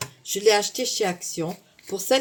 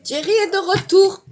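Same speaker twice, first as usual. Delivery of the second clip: high, loud showman announcement; excited announcement; 10 semitones higher, 8 dB louder than usual. Pretend. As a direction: announce it like a game show host. Triumphant announcement of tool.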